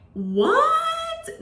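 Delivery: a high, sung-out What of disbelief; shocked disbelief. Retold or imitated. To the officer, stunned disbelief at the ticket. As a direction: act stunned at the accusation.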